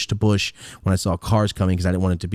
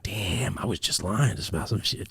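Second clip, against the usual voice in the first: quiet inner voice; 8 dB quieter, slower than usual. Retold or imitated. Inner thought while talking.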